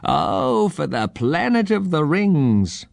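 high, drawn-out bird cry; squawking. A narrator's reading. Old bird-man calls to his home planet, high squawk. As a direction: squawk it slowly up at the sky. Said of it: "looked up at the sky and squawked".

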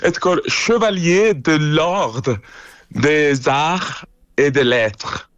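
careful, slow French pronunciation of the award name; French accent. Real, not acted. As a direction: say the title slowly in proper French. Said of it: "when you say it in a French accent".

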